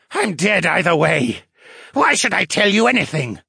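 defiant. A narrator's reading. Captive merman answers his captors' threats, defiant. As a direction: push back at the threat, voice climbing.

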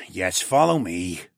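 slow, resigned character voice; weary, drawn-out consent; sighing. A narrator's reading. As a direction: sigh it out slowly. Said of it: "Mael sighed".

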